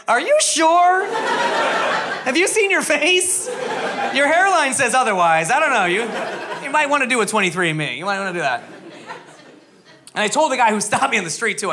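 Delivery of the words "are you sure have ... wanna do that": mock incredulous questioning; skeptical people. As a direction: needle him like disbelieving friends. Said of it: "They're always like".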